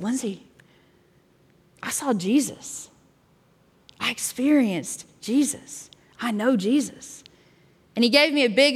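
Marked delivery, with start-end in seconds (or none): 0.0-7.2 put-on soft, hushed retelling of a weeping boy; a touch of teary awe, kept quiet; tearful. Boy who just gave his life to Jesus tells pastor, softly tearful. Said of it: "just weeping".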